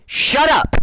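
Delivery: sharp high-pitched shut up; sudden shout. Real, not acted. Angry rant at neighbor.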